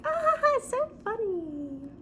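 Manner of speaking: high, slow, mock-polite voice; fake polite laugh. To the creepy coworker, a high fake-polite brush-off. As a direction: pretend to find it funny.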